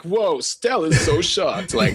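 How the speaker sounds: high, drawn-out mock astonishment; mock amazement. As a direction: exaggerate the shock, stretching the words. Mimics everyone's exaggerated reaction.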